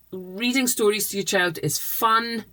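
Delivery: normal talking voice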